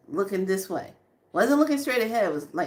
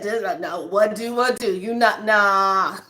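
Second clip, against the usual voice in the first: loud, through real laughter; words bursting out between laughs; laughing refusal; 5 dB louder than usual. Real, not acted. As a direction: crack up while refusing outright. Cracks up retelling her refusal.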